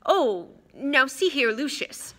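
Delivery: flustered objection; alarmed official trying to step in. Acted. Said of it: "looking alarmed".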